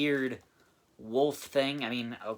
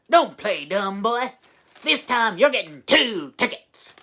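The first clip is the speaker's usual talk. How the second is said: southern sheriff; 7 semitones higher, 8 dB louder than usual. Retold or imitated. Reviewer imitating the sheriff ticketing, southern accent. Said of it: "It's a southern accent".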